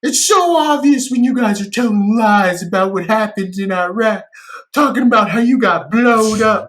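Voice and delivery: high, loud sneering voice of a comments troll; whiny accusing internet critic; mocking know-it-all commenter. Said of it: "he's like".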